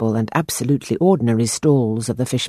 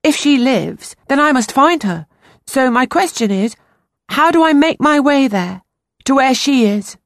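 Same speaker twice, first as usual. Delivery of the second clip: put-on higher, louder pleading boy; urgent boy; 11 semitones higher, 4 dB louder than usual. A narrator's reading. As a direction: insist desperately as the young boy. Boy Peter voiced in story.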